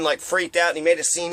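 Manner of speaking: ordinary delivery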